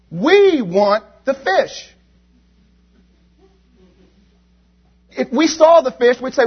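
high, loud, impassioned preaching. Acted. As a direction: preach it with fire to the congregation. Preacher voicing complaints, then exhorting.

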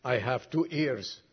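preacher reciting a moving poem to the congregation; poem recital. Acted. Reads a whole poem aloud.

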